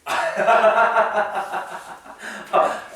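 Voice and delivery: a burst of real laughter at the answer; hearty amused laughter. Real, not acted. Student answers instantly.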